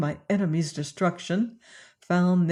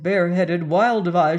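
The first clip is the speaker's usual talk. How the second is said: plain reading voice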